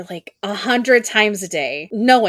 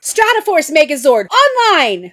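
high, loud hero call; cockpit shout; 8 semitones higher, 6 dB louder than usual. Acted. Pink Ranger in her cockpit, a high loud call. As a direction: announce the Megazord with full force.

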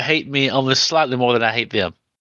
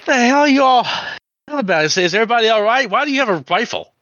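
put-on high, loud, rattled old man; startled old drunk; 9 semitones higher, 3 dB louder, faster than usual. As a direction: barge in alarmed and confused. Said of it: "shaky hand on the flashlight".

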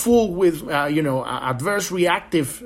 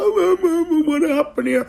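high, dazed and confused, a mock stunned victim; bewildered squeaky mock voice; 13 semitones higher, 4 dB louder than usual. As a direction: play someone just knocked senseless, much higher. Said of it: "You're like".

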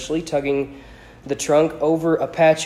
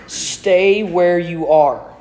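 very slow, louder quoted command; grave quoted order; 3 semitones higher, 5 dB louder, much slower than usual. A narrator's reading. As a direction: spell out Dumbledore's order word by word. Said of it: "Dumbledore says".

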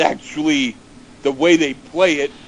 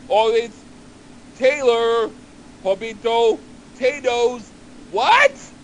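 calling out; 6 semitones higher, slower than usual. Retold or imitated. Garbled dinner call continues.

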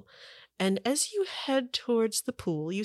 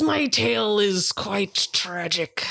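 slow, dramatic pirate lament; hammed-up betrayed old pirate; tragic pirate sword; 3 dB louder, slower than usual. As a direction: spin a tragic sea tale, savoring each word.

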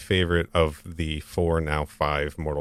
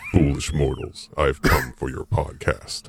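slow theatrical villain menace; 3 semitones lower, slower than usual. Acted. Gag voice announcing himself to hosts.

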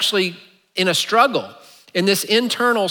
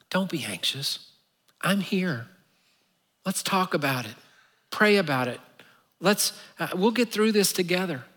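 tender reassurance; 7 dB quieter than usual. Retold or imitated. God comforting an anxious believer, soft and tender. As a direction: speak it gently, like a loving parent. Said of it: "tone and intention was more like my mom".

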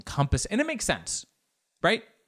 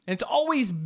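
usual speaking voice

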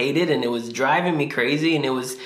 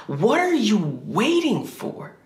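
drawn-out, pitched higher, bitter challenge to God; slow frustrated demand; 7 semitones higher, slower than usual. Retold or imitated. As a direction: lean on every word, slowly. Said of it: "I remember yelling at God".

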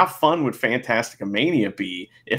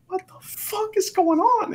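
retold disbelief. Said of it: "And I was like".

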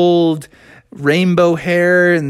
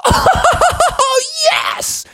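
high whooping laugh into a yes; ecstatic Froggy. Acted. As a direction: whoop with glee, then cheer. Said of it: "And Froggy was like".

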